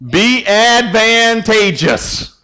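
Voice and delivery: very slow, each syllable stretched out; slow and careful. Real, not acted. Pastor teaching the group a long word, slow and careful. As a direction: take the long word slowly, piece by piece. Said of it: "I have to say it kinda slow".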